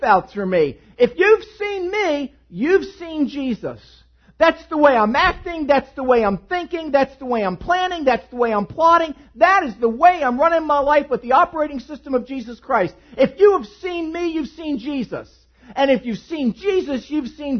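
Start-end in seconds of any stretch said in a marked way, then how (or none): 1.0-3.8 proclaiming. Acted. Preacher proclaiming. 4.4-15.3 loud, high preaching run. Acted. Preaching at full force.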